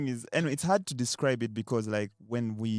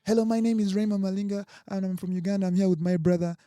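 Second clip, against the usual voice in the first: higher-pitched, projected introduction; mock public introduction, pitched up; imitated self-introduction; 7 semitones higher, 3 dB louder than usual. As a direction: announce yourself eagerly to a crowded room. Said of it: "he went like".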